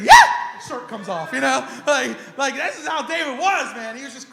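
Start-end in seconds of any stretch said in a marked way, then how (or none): 0.0-0.1 sudden exuberant shout; wild cheer. Acted. Preacher acting out David's clothes flying off, wild shout. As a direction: let out a hyped "Yeah!".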